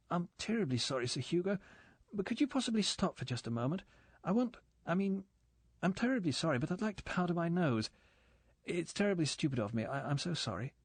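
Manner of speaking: put-on flustered, apologetic young woman; stammering, nervous pleading. A narrator's reading. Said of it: "said Galen nervously".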